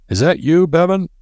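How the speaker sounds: put-on old professor bellowing a welcome; roared greeting. A narrator's reading. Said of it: "roared a greeting".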